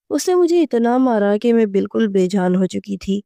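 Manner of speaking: usual speaking voice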